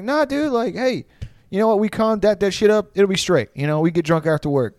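high, easygoing impression of the bar manager; laid-back bar manager. Imitating the manager smoothing things over on the phone, high and easygoing. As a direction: make peace in a high, breezy voice. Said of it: "he was like".

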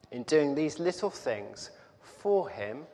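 normal talking voice

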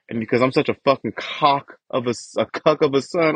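ordinary talk, nothing marked

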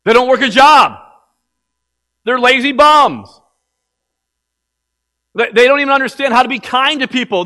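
loud, high, hammering sermon; fiery preaching. Acted. Pastor scolds lazy believers, loud fiery preaching. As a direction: preach it hard at the lazy ones.

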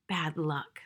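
whispered thought; hushed inner thought; private thought. A narrator's reading. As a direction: breathe it to yourself under your breath. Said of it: "thought Missus Frisbee".

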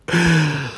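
sharp, high gasp of shock; frightened gasp. Retold or imitated. Storyteller reacting to the ghostly caller, gasping in shock. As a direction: catch your breath in fear.

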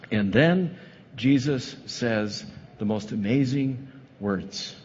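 normal talking voice

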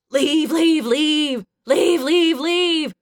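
loud, high, climbing chant; death-metal chant broken by roars; screaming death metal. A narrator's reading. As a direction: scream it in pounding waltz triplets. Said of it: "like a screaming death metal waltz".

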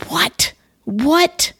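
second what leaps high in disbelief; stunned double take; rising shocked what; incredulous what. Real, not acted. Shocked by news of death.

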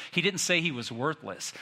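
ordinary delivery

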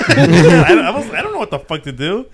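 telling it through real laughter; laughing. Real, not acted. Storyteller recalling his helpless refusal to friends, laughing. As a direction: break up laughing mid-story.

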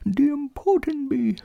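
very high, soft, earnest little bear; thin-voiced toy bear giving careful instructions; Winnie the Pooh. A narrator's reading. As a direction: explain a plan softly in a small, high voice.

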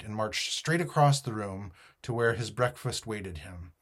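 ordinary delivery, nothing marked

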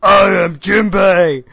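hammed-up stilted Jinbei impression; mocking wooden dub voice. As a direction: read it like the worst dub actor alive. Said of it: "everything Jinbei says with the".

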